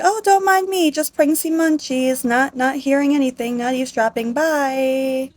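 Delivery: high, airy mock-casual voice; feigned innocence. Eavesdropper slips past the quarrel for munchies, feigned innocence. Eavesdropper pretends to be just passing.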